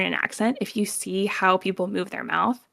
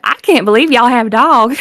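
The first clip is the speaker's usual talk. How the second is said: cartoony Southern accent; 3 semitones higher, 11 dB louder than usual. Shown as a demonstration. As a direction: swing the pitch up and down, hammed-up Southern. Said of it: "the cartoony one".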